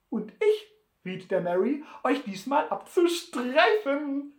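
high, smirking needling; amused gloating. A narrator's reading. Sam tells his thrown rival about the mule, smirking. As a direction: tease the loser with a smirk, voice lifting. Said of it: "schmunzelte Sam".

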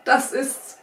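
lightly amused, punchy agreement; laughing. A narrator's reading. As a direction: say it with a touch of amusement. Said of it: "lachte Sam".

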